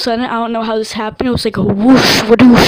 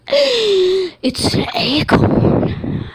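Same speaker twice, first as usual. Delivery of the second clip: very slow, higher, breathless reveal; awed gasp; 9 semitones higher, 3 dB louder, much slower than usual. Retold or imitated. Dreamer spotting Acorn, slow awed reveal. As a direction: draw it out in stunned wonder. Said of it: "I was like, gasps".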